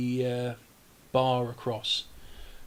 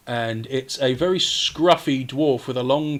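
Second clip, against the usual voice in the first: ordinary talk, nothing marked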